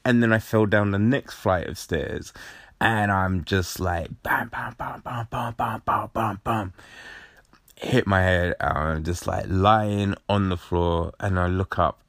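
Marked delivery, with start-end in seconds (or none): 4.2-6.7 rapid string of spoken impact noises; evenly repeated mock crash sounds; imitated tumbling thuds. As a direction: bounce each bam out like a stair hit.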